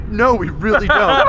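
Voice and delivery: high, loud retold protest; insistent denial. Friends answering the officer again, loud mock protest. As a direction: protest loudly, half laughing.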